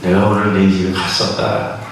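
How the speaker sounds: Jesus voice. Retold or imitated. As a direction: answer low and steady, as Jesus. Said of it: "그랬더니 예수님이".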